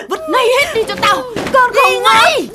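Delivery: angry yelling. Acted. Mother shouting at daughter to throw posters out. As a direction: scream the order at her.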